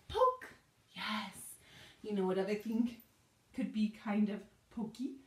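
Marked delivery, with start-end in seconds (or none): 0.1-0.5 high playful poke. Shown as a demonstration. Playful scorpion poke cue.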